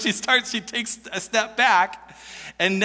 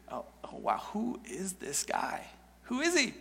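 hushed astonishment; 12 dB quieter than usual. Retold or imitated. Woman imagined, startled by Jesus.

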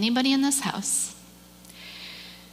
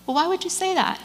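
imitating an interviewer, pitched up; lightly voiced questioner, higher than usual; street interviewer; 8 semitones higher, faster than usual. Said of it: "a gentleman approaches him with a microphone".

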